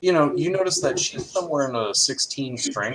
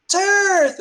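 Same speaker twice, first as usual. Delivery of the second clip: very high, loud squeal; united excited cry; 8 dB louder than usual. Acted. Gnome girls greeting heroes together, excited cry. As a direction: draw it out like a delighted chorus. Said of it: "you all hear a united".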